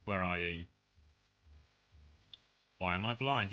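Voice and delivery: ordinary talk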